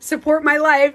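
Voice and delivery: playfully over-the-top begging for support; joking plug. Pretend. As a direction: belt out a tongue-in-cheek plug. Joking plug, then "I'm joking.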